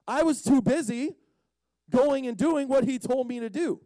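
high, loud mock exasperation; exasperated. Healed man explaining he was busy, exasperated. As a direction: push it out as an indignant excuse.

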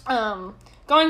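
normal talking voice

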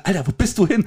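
retold baffled call, jumping up high; comic exasperation, rising sharply in pitch; mock bewilderment. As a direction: call after someone who vanished, playing up the disbelief. Retelling chase of vanishing employee.

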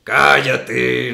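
a stranger's voice bursts out of him in a snap; sudden strange voice. Retold or imitated. Actor in vagabond costume snapping at a friend, a sudden unfamiliar voice. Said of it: "me sale una voz que yo nunca esperé".

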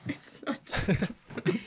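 giggling whisper, slow and breathy with laughter; laughing whisper. Real, not acted. Teased about her voice, answering in a laughing whisper. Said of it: "your voice is changing".

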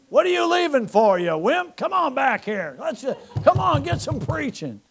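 high, loud teasing call; playful high jeering shout; mock heckler. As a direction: taunt the ones leaving. Mock congregant calling out.

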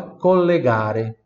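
slow, loud collegare; slow model word. Shown as a demonstration. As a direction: say collegare syllable by syllable. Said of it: "Repita em voz alta". (collegare pronunciado devagar)

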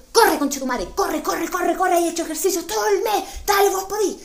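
urging herself on. Retold or imitated. Urging herself to chase the phone thief, fired up. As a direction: push yourself to sprint. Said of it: "yo en tres segundos yo digo".

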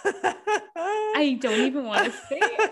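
slow, laugh-crying disbelief; laughing so hard it nearly cries. Real, not acted.

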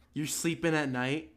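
ordinary talk

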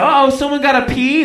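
high, teasing singsong jeer; mocking. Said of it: "They made fun of me".

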